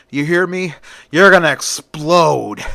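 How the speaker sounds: loud villain's taunt, building to the last word; gloating threat. A narrator's reading. Villain gloats while attacking hero.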